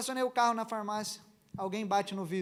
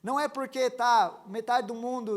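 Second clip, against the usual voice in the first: normal talking voice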